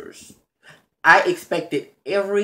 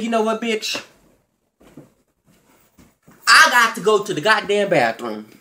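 mock retort; 4 semitones higher than usual. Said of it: "I said".

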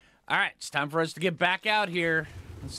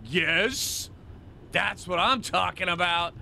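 raised, triumphant cheer; excited celebration; 5 semitones higher, 4 dB louder, slower than usual. Real, not acted. Gamer cheering on finding the one wanted, excited. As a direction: celebrate the find with rising energy. Celebrating a good find in game.

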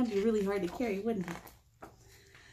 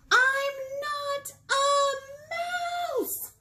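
high, long drawn-out yell; 3 dB louder, much slower than usual. Acted. Girl finally yelling at her mom, long high yell. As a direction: yell it out, fed up. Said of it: "need to yell".

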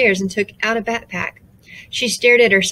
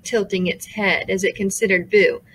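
usual speaking voice

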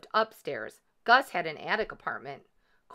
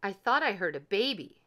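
usual speaking voice

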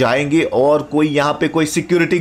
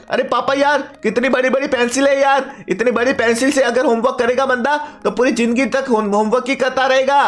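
higher-pitched son talking to his papa; son's high, chatty voice; eager son; 6 semitones higher than usual. Acted. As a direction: play the excited kid calling out to papa.

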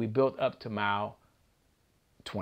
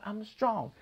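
pitched-up retold yell; mild mock yell; 8 semitones higher than usual. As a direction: echo the chant as a crowd would. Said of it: "They yelled".

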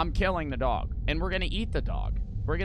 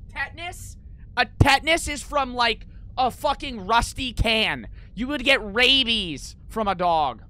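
loud and much higher, correcting chat in disbelief; exasperated rant; 9 semitones higher, 7 dB louder than usual. Real, not acted. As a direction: shout down a wrong answer, fed up. Ranting at chat over tetanus.

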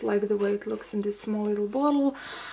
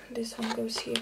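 whispering; 9 dB quieter than usual. Real, not acted. Aside while arranging altar items.

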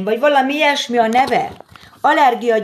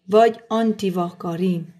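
ordinary delivery

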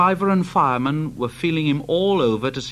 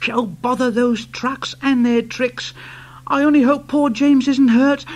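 Thomas voice; 9 semitones higher, 5 dB louder than usual. Acted. Thomas worried.

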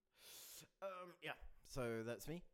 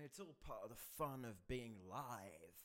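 ordinary talk, nothing marked

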